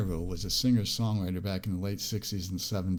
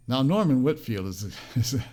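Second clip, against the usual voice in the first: ordinary talk, nothing marked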